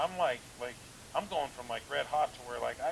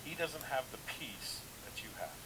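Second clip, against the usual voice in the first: slow, soft whisper; whispered word from the Lord; 8 dB quieter, slower than usual. Retold or imitated. The Lord quietly answering the angry pastor, slow whisper. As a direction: hush it like a secret spoken inside. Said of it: "The Lord says".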